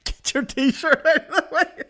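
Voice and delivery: slow, laughing through the line; laughing repeat. Real, not acted. Repeating the clip's words to his listeners, laughing. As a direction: crack up while echoing the clip.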